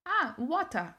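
"water" as "wotah", no final r; Australian-style "water", open ending; local pronunciation repeated. Retold or imitated. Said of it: "repetir de novo a pronúncia".

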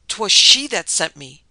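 loud, slow accusing scream; screaming accusation. A narrator's reading. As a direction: scream it at the whole court. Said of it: "she screamed".

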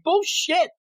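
imitating a scornful villain, pitch up high; retold furious objection, half-hearted; mock angry rant. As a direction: spit out the insult like an offended tyrant. Voicing Sinestro's angry objection.

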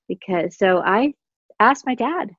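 ordinary talk, nothing marked

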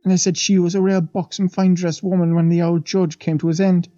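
a lightly voiced old maid, awed; old Irish housemaid. The maid leans in to the young men, lightly voiced and awed. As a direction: tell it like a wide-eyed servant gossiping.

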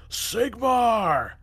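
high, loud yell; yelled battle cry. A narrator's reading. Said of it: "yelled Block".

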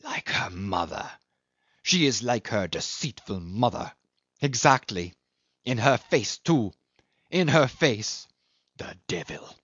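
bitter, venomous spitting; a touch of venomous spite; spiteful. A narrator's reading. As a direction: spit the insult out. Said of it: "shot out an infamous word".

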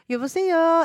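high, cutesy Korean phone greeting; cute Korean girl. Acted. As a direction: imitate a sweet girl answering the phone. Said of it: "sounds so cute".

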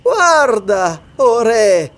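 high, loud mocking call; ironic exclamation. A narrator's reading. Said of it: "esclamò Atla in tono ironico". (esclamazione acuta in tono ironico)

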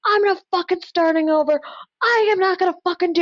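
high-pitched mock scream of defiance; retold screaming. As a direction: imitate a client's high, indignant yelling. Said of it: "screaming at me".

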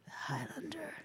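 breathy whispered echo of the title. Acted. Co-host echoing the movie title in an awed whisper. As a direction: whisper it back like an awed fan.

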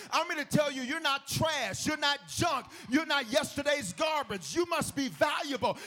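high, forceful preacher delivery; emphatic preaching. Real, not acted. Preacher telling listeners their worth, high and emphatic.

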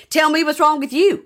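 high, hammed-up demand; mock confrontational character voice; imitated accusing man. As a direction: do his mannered voice, pushing for an answer. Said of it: "which is exactly how he talks".

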